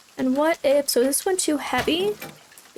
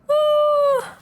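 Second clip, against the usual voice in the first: high panicked voice; fearful, squeaking with panic; terrified gibbering; 11 semitones higher, slower than usual. Real, not acted. Scared in horror game.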